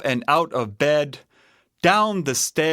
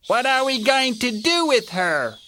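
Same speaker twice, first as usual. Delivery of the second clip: high little bear voice; Baby Bear crying out; 10 semitones higher, faster than usual. A narrator's reading. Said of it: "cried Baby Bear".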